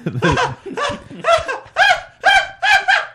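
imitated can't-breathe laugh, very high and loud; Roman. Friend starts screaming with laughter, imitated high breathless cackle. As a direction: mimic screeching, breathless hysterics. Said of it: "he can't breathe laugh".

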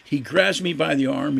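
usual speaking voice

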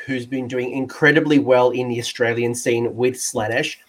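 ordinary delivery

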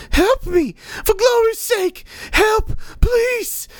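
very high-pitched screamed plea; panicked pleading. Acted. Dying old woman begging the cleric for help, panicked shriek. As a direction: cry out desperately, high and strained.

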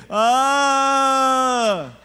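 long, loud, high held wail; hammed-up child's howl, one sustained note; imitated kid's wail. Imitating a kid hit at a party, wailing loudly. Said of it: "I hear this wailing in the back".

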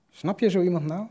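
a friend repeating Rosa's indignant cry. Retold or imitated. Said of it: "riep Rosa verontwaardigd".

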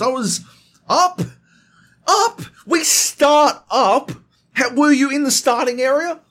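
exasperated yelling. Retold or imitated. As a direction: demand answers in disbelief. Exasperated interrogation.

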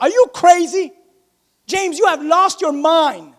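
drawn-out, disbelieving challenge; incredulous. Acted. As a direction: act out stunned disbelief at outrageous advice. Acting a stunned reply to James.

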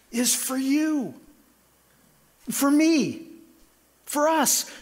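a preacher's swelling appeal; rising preaching climax. Real, not acted. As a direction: climb in pitch, pleading and urgent. Climax of the sermon's point.

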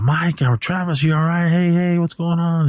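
high, urgent voice calling to a friend; worried calling out. Retold or imitated.

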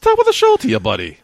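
hammed-up Irish, squeaky then dropping on the last word; mock Irish accent. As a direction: repeat it in a falsetto fake Irish accent. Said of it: "supposed to be your Irish accent".

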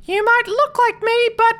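very high, loud squeaky threat; Flea. Acted. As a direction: put on a tiny shrieking character voice. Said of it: "and Flea says".

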